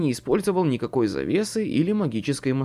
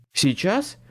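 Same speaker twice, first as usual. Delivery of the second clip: higher, louder, panicky protest; squeaky alarm from a frightened man; panicked squeak; 6 semitones higher, 4 dB louder than usual. A narrator's reading. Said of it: "панически пискнул второй мужской голос".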